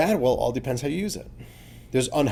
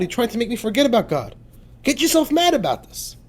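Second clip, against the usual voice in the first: indignant outrage; 8 semitones higher, 3 dB louder than usual. Shown as a demonstration. Worshipper scolding his animal soul, indignant outrage. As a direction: rage at it like a wronged man. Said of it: "You should scream ... saying like this".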